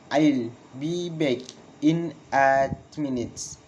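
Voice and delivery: slow, pause after "back", "minute" falling; slow falling intonation. Shown as a demonstration. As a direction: break it up slowly for learners. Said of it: "Falling intonation in statement".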